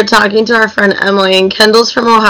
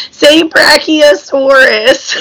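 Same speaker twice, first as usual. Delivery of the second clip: slow, higher, exaggerated Midwestern accent; 5 semitones higher, much slower than usual. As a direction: draw brachiosaurus out in a Chicago accent. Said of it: "really bad Miss West-, Midwestern accent".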